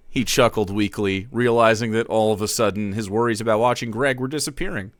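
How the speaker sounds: plain reading voice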